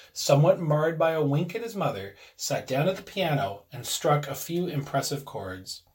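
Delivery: usual speaking voice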